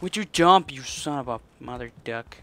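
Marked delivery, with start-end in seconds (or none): 0.0-0.7 frustrated yell. Real, not acted. Yelling at the character.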